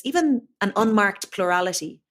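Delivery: ordinary talk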